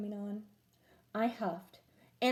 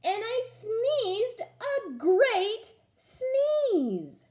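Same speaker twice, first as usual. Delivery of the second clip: very slow, high, loud sneeze build-up; big drawn-out sneeze; 10 semitones higher, 5 dB louder, much slower than usual. A narrator's reading. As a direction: stretch it into a huge sneeze. Wolf sneezes a great sneeze.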